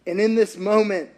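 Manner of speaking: normal talking voice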